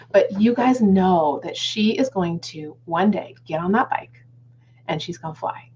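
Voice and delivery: ordinary talk, nothing marked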